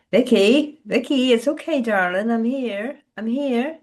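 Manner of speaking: calm her down gently; reassuring. A narrator's reading. Faye soothing.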